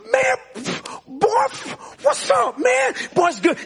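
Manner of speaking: high-pitched, delighted buddy greeting; excited greeting. Acted. Churchgoer hugging a favorite friend at church, excited greeting.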